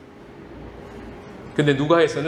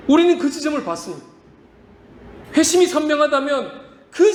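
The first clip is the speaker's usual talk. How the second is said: impassioned preaching; 8 semitones higher than usual. Acted. Pastor exhorts congregation, high and impassioned. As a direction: press the point to the church.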